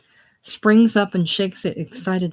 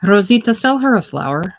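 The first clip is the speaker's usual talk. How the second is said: loud, raised call across a plaza; shout; 4 semitones higher, 8 dB louder than usual. Acted. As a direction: shout out an order to a vendor. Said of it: "He turns and shouts".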